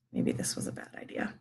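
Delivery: whispered under her breath; a rueful whisper to herself; whispered aside. Real, not acted. Mom giving up mid-video, whispering to herself. Aside while kids interrupt.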